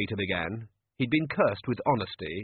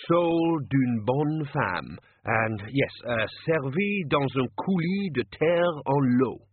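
slow, hammed-up French accent; mock French menu; 4 semitones higher, slower than usual. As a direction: announce the mud dish like haute cuisine. Manager invents fake French menu.

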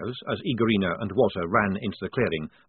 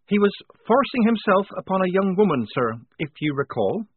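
Polly's firm defence; 10 semitones higher, 4 dB louder than usual. A narrator's reading.

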